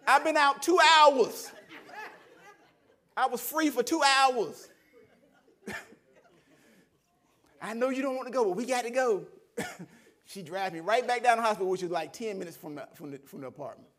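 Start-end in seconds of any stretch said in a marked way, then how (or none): 0.1-4.7 loud, high, drawn-out outcry; retold indignant protest. Protesting being sent back to hospital. 7.6-9.2 louder, higher firm urging; lightly voiced wife overruling him; insistent wife. Wife insisting they return.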